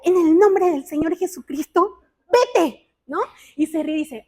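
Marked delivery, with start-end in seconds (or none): 0.0-2.9 mock rebuke. Mamá reprende al asesino. (reprensión fingida, fuerte y rápida)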